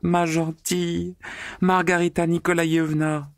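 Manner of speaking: galloping cry. A narrator's reading. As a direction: shout it over the rushing wind. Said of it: "criait Natacha en galopant".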